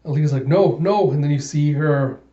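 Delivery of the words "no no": higher, louder pleading no; desperate protest. Acted. Alita pleading as robots condemn her, in desperate protest. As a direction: beg them to stop.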